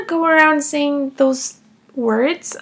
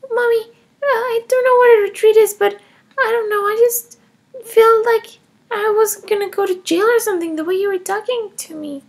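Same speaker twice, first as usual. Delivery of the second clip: put-on higher, defiant little girl voice; child Emma; 6 semitones higher, 3 dB louder than usual. Kindergartner Emma defending taking her mom's money, higher child voice. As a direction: play a cheeky kindergartner justifying herself.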